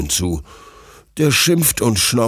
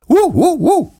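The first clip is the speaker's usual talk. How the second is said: high, loud little barks; excited puppy yaps; 6 dB louder than usual. Acted. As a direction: yap three times like an eager pup.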